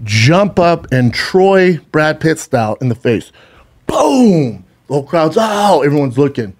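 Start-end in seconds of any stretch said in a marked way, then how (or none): 3.9-4.6 high, falling "boom" sound effect; imitated punch boom. Storyteller imitating the punch landing, high-pitched boom. 5.4-5.8 high-pitched crowd gasp-cry; imitated crowd "oh". Storyteller as the crowd watching a punch, high "oh!".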